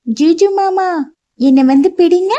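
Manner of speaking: put-on high, playful child calling out; a small girl daring him to catch her; playful little girl. A narrator's reading. Girl runs, calls uncle. (உயர்ந்த குரலில் விளையாட்டாக அழைக்கும் சிறுமி)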